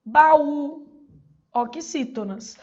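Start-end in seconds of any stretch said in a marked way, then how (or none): 0.1-0.9 "baú" with a loud, stressed final Ú; stress on last syllable. Shown as a demonstration. Teacher giving oxytone example, stressing the last syllable of "baú". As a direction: punch and hold the Ú at the end of "baú". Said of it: "A última sílaba é tônica".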